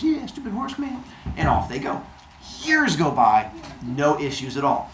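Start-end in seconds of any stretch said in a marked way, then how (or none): 0.0-1.0 higher, airy echo of her husband; echoing wife. Acted. Wife echoing Heracles about the centaur, in a mock higher voice. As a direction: chime in agreeing eagerly. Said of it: "She goes".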